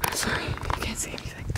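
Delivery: breathy whisper so nobody nearby hears. Real, not acted. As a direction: whisper to the camera. Quietly filming at the water.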